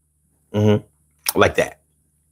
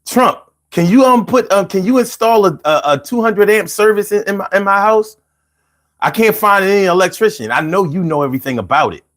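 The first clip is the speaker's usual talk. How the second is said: pleading caller; 6 semitones higher than usual. Retold or imitated. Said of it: "Like, yo".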